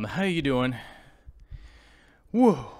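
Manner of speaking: ordinary delivery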